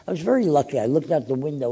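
ordinary talk, nothing marked

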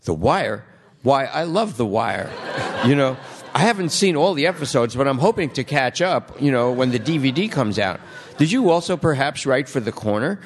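high, bright-eyed fan gush with laughter; sudden excitement. Retold or imitated. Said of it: "the kid all of a sudden goes".

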